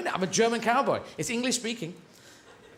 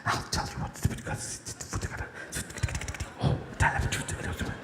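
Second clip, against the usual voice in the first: breathy whisper, low and soft; horse whisperer; 6 dB quieter than usual. Retold or imitated. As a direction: whisper gently into the horse's ear. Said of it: "horse whisperers".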